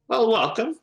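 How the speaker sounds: high put-on gnome voice; a high, accented little welcome; gnome cleric Corky. Gnome cleric welcomes a newly introduced guest, high and accented. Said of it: "trying to get this accent here".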